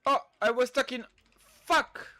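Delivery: sudden high, loud cry of frustration; frustrated outburst. Real, not acted. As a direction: yelp it out when the unit gets stuck. Gets stuck in the game.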